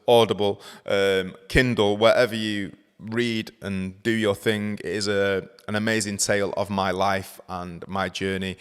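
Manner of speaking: loud, upbeat promo voice; ad read. Acted. Reading a plug for her book to listeners, loud upbeat ad voice.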